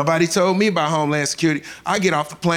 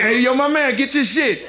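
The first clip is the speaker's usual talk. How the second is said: casual guard; 6 semitones higher than usual. Retold or imitated. Guard waving cocaine through.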